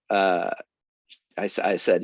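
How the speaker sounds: usual speaking voice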